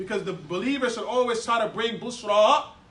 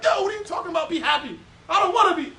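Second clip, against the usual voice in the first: 1 grumpy mock objector; 6 semitones higher, faster than usual. Imitating a grumpy person.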